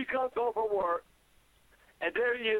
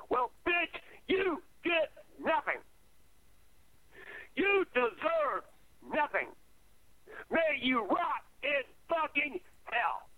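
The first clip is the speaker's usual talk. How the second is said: slow, high-pitched angry damning; bitter curse; 4 semitones higher, slower than usual. Real, not acted. Cursing the boys' grandmother for abandoning them, bitterly angry. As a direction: spit out each word as a slow, deliberate curse. Cursing absent Tina to camera.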